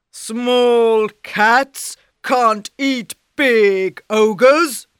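loud, slow ogre scoff. Acted. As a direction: boom it with contempt.

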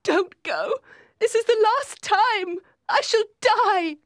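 very high, loud wail; shrieked, frantic begging; desperate plea. A narrator's reading. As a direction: cry out frantically as if dying. Dying Catherine begs him to stay.

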